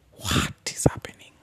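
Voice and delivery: a hushed, whispered what is happening; whispered disbelief. Retold or imitated. The speaker reacting to the sister's miscarriage, a stunned whisper. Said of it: "and I'm like".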